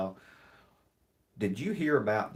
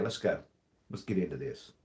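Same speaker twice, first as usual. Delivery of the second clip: ordinary talk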